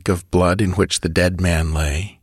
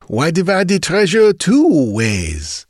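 slow, raised, gloating; murmured; 11 semitones higher than usual. Acted. Chief over the rat he killed, slow gloating. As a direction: savour the betrayal slowly. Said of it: "murmured Lalou".

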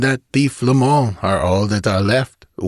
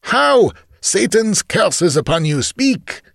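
high, raised, angry command; furious demand; 13 semitones higher, slower than usual. Acted. Chief to dying man, furious demand. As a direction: shake the answer out of him.

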